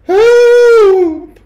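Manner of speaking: very high, loud scream for help; piercing, drawn-out shriek; terrified scream. A narrator's reading. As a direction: scream it out in panic. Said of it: "screamed Missus Jenkins".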